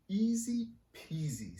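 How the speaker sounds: high, slow, sing-song easy peasy; bouncy little girl. Acted. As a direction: tease it lightly like a proud kid. Astrid voiced.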